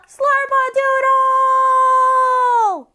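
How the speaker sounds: long, high yell with a drawn-out ending; full-volume outdoor shout. Shown as a demonstration. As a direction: holler it as loud as you can, to carry far. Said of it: "Did I just hear you yell".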